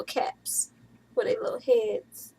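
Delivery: soft unvoiced whisper; a hushed whisper over tiny caps; whispered coo. Real, not acted. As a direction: whisper it tenderly.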